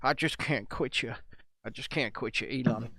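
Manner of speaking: lovelorn cowboy. Acted. Jack to Elon as Brokeback lovers, low lovelorn impression. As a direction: play a hushed film love confession. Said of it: "It's another Brokeback Mountain case".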